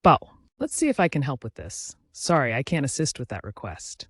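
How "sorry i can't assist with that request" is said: plain reading voice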